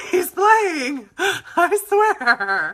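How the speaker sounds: can't keep a straight face insisting he's fine; laughing protest. Retold or imitated. Narrator assuring the staring passers-by, laughing loudly.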